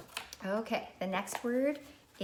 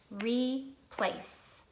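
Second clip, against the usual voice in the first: "Replace" split as re, pause, place; clapped syllables; 3 semitones higher than usual. Shown as a demonstration. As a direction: clap out "Replace" in two separate beats. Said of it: "Can you clap it with me?".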